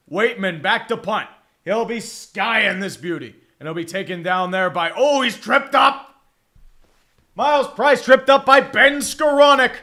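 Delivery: excited, loud football call; live play-by-play. Real, not acted. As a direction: call it as it happens, rising on the big moment. Calls a live punt return.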